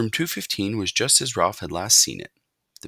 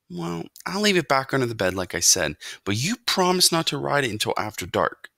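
lightly voiced boy, a little higher; hint of a boy setting terms; boy character, bargaining; 7 semitones higher than usual. A narrator's reading. Boy makes mouse promise to wait until dark, lightly insistent.

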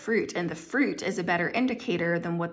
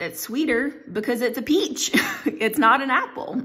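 acted-out emphatic correction, higher and louder with a laugh; amused insistence; 5 semitones higher, 5 dB louder than usual. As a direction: hammer the point home, half laughing.

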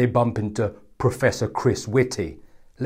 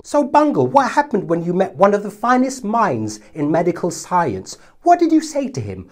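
high, chirpy mock reporter; lightly voiced chat show host; mock interviewer; 8 semitones higher than usual. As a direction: ask it like a cheesy TV interviewer. Mock interviewer questioning Bungle.

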